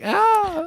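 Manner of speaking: high mock scream; high-pitched kid's wail, imitated; imitated crying out. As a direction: let out a child's high cry. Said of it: "crying out, like".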